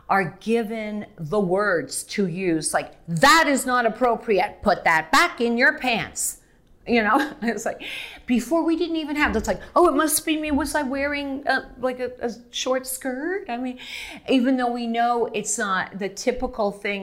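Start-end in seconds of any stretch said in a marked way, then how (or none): 3.1-6.4 firm telling off. Retold or imitated. Telling off harasser. 9.7-13.4 self-doubting victim. Retold or imitated. Victim blaming herself.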